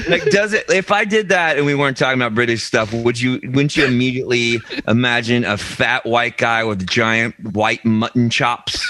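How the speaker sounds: normal talking voice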